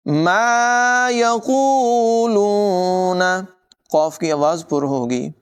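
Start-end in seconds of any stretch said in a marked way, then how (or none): 0.1-3.2 slow, higher melodic tajweed chant; Quran recitation. Shown as a demonstration. Teacher recites the verse for students, slow melodic chant. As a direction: chant each word long and flowing.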